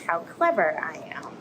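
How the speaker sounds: whispered playful boast. Acted. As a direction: whisper it like a secret to the kids. Octopus boasts after escape.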